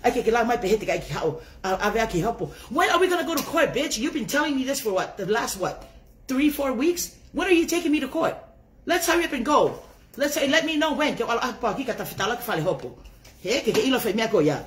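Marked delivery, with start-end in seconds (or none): 2.7-11.1 taunting challenge. Real, not acted. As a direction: dare her to go to court. Taunting rival about court date.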